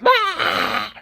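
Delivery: very high mock shriek; reacting shriek. Shown as a demonstration. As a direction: shriek as an exaggerated startled reaction. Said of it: "That's reacting".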